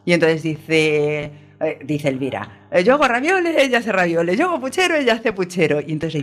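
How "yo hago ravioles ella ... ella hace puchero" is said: high bouncy singsong, peaking on each yo line; mocking singsong complaint. Elvira mocking the neighbour who copies her, high singsong whine. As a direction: whine it like a petty neighbour, much higher.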